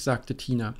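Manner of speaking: ordinary delivery, nothing marked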